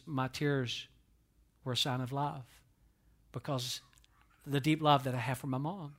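low, soft and halting, with long pauses; subdued grief. Real, not acted. Preacher recalls walking to mom's casket, subdued and grieving.

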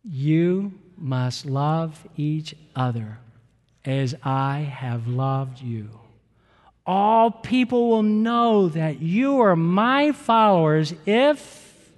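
preacher reading the scripture slowly and solemnly to the congregation. Shown as a demonstration. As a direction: read the verse slowly, building to a lift on if. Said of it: "Let's read it".

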